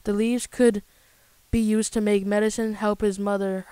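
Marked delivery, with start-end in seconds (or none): none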